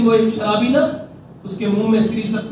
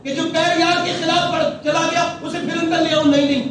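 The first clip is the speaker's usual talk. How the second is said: agitated refusal; 8 semitones higher than usual. Retold or imitated. Inner refusal, voice rising.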